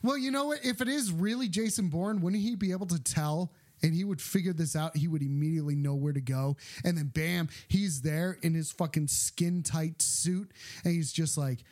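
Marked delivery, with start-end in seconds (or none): 3.8-11.6 deep movie hero voice. Retold or imitated. Voices Jason Bourne.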